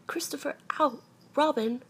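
high call broken by an ow. A narrator's reading. Pooh calls out from the balloon, high yelping call. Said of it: "called out the cloud".

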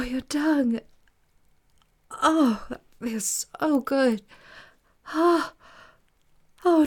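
high, loud breathy moans; moaning. Acted. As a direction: moan with rising pleasure. Moaning in pleasure.

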